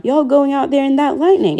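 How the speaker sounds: put-on a frightened little boy pleading; scared cry. A narrator's reading. Boy asking Mama about the lightning, fearful and high. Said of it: "cried Christopher John".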